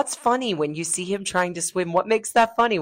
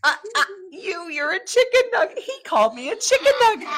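mock outrage; 7 dB louder than usual. Host mock outrage at joke.

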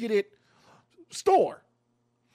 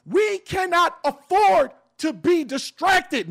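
shouting; 6 semitones higher, 5 dB louder, slower than usual. Real, not acted. Passionate appeal to listeners.

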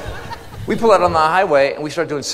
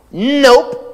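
higher, louder flat nope; cheeky kid refusal; 8 semitones higher, 5 dB louder than usual. Acted. Kid refusing the bus driver, higher, louder. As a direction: toss off a bratty little no.

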